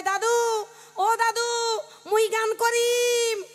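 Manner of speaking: high, loud, slow whining cry; a desperate little boy crying for grandpa; whining child calling grandpa. Acted. Grandson at midnight crying out to grandpa, whining loudly. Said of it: "ডেকে ডেকে কয়".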